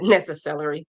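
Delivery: "Necessary" repeated as "nessa-cellery", low; playful mispronunciation. Retold or imitated. Crafter enjoying a viewer's ruined word, playful mispronunciation. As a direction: savor "Necessary" ending like "celery". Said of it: "I like that".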